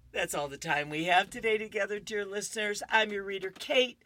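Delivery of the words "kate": ordinary delivery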